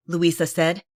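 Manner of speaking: ordinary delivery, nothing marked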